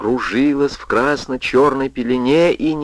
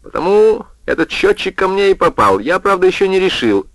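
smug; 7 semitones higher than usual. A narrator's reading. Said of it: "самодовольно поджал губы".

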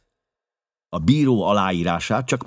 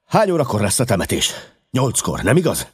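higher, anxious questioning; restless; 6 semitones higher, 4 dB louder, faster than usual. A narrator's reading. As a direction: ask urgently, on edge. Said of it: "kezdte nyugtalanul".

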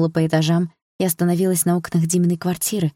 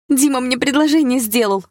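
high, thrilled burst; excited announcement; 7 semitones higher than usual. Acted. Daughter tells mother of the proposal, excited. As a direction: blurt out the big news, pitched right up.

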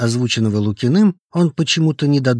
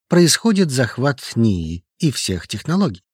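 ordinary delivery, nothing marked

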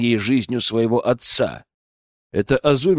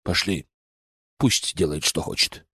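lower, softer weary dismissal; dismissive; 7 semitones lower, 5 dB quieter than usual. Acted. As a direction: wave it off quietly. Yoichi dismisses, walking off.

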